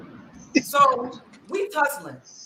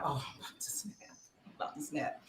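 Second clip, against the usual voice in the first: hushed, under the breath; whispered warning; 7 semitones lower, 19 dB quieter than usual. Retold or imitated. Telling her sister she's about to snap, in a whisper. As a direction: whisper it, holding in anger. She warns she'll snap.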